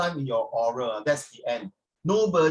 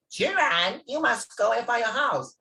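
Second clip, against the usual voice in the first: mommy pig; 7 semitones higher than usual. Acted. Mommy pig voiced in story.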